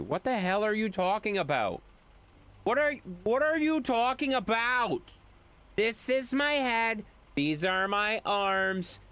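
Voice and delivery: high-pitched, exasperated protest; strained high indignation, drawing out the explanation; angry voice. Acted. Streamer insisting to chat he doesn't blend into his green screen, exasperated. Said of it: "I like your angry voice".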